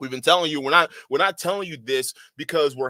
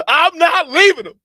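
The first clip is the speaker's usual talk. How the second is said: louder mock defiance rising to a laughing squeal; mocking Myron; 2 semitones higher, 9 dB louder than usual. Mimicking Myron refusing to drop his co-host, mock defiant. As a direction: ham up the stubborn host. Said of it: "Myron's coming on and say".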